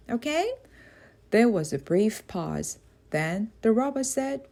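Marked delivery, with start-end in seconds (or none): 0.1-0.5 high, hopeful rising okay; a girl coaxing a friend; little girl voice. A narrator's reading. Jaya asks robot.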